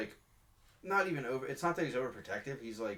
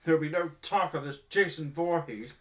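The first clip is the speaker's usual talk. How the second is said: higher, louder stern decree; stern captain; 5 semitones higher, 7 dB louder than usual. Retold or imitated. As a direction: forbid the topic sternly. Mimicking snobby captain.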